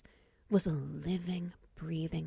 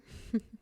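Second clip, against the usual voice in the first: short breath of a laugh; small amused huff. Acted. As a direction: chuckle under your breath. Amused at the stranger's position.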